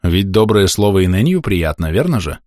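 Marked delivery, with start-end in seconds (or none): none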